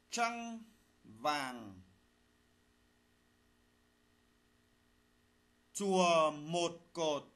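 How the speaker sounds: slow dictation, phrase by phrase; dictating. Shown as a demonstration. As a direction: read it out slowly for pupils to write. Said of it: "lắng nghe thầy đọc bài, chúng ta soát lỗi".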